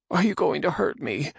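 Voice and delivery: high nervous man voice; frightened Radagon. Acted. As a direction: ask in timid fear.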